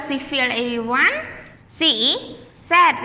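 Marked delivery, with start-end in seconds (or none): 1.7-3.0 slow, spaced model with she is sad; slow teacher demo of she is sad; slow model sentence. Shown as a demonstration. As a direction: say it slowly so the class can echo. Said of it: "Repeat after teacher".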